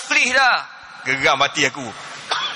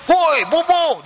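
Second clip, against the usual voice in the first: higher-pitched mock scolding shout; retold scolding; 6 semitones higher, much slower than usual. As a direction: call out a sharp telling-off, imitating an annoyed worker. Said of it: "Dia marahnya Bangla tu".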